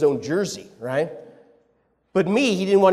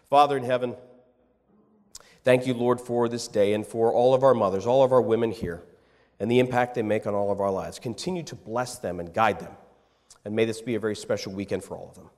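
closing prayer; 6 semitones lower than usual. Acted. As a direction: pray reverently over the mothers.

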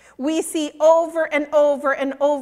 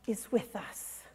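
hushed emphasis; 5 semitones lower, 14 dB quieter than usual. Acted. Preacher pressing the point home to listeners, hushed. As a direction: drop much softer and lower on "is with us". Preacher stresses God's presence.

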